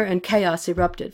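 usual speaking voice

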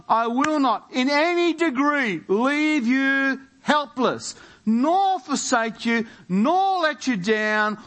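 high, slow, drawn-out preaching; emphatic scripture proclamation. Real, not acted. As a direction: proclaim each promise with mounting insistence.

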